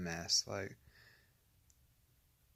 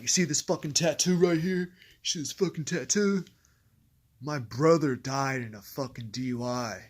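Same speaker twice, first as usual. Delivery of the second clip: loud, high, furious confrontation; imitating a cellmate's furious threat; angry cellmate; 5 semitones higher, 10 dB louder than usual. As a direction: get in his face about the tattoo. Cellmate confronting him angrily.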